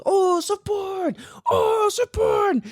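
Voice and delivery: put-on very high, slow, squealing little-kid shout; toddler squeal. Dad imitating his toddler son spotting surfboards, high squealing shout. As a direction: squeal it like an overjoyed three-year-old spotting a surfboard. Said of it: "He goes".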